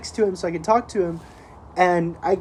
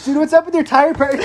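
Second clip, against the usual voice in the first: laughing mock yell; 10 semitones higher, 10 dB louder than usual. Friend mock-quoting the speaker.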